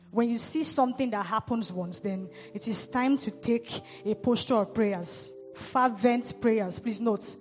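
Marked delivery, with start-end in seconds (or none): 5.5-6.8 Fervent drawn out slowly and weighted; slow emphatic Fervent; stress on fervent. Shown as a demonstration. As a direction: lean hard on Fervent, slowly. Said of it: "I'm emphasizing on the word fervent".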